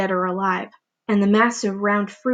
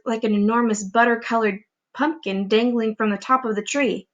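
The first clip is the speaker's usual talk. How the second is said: ordinary delivery, nothing marked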